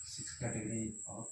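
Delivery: very slow, quiet dictation while writing. Shown as a demonstration. Teacher dictating notes to the class, slow and quiet. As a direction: spell it out word by word as you write.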